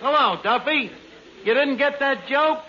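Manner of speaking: ordinary talk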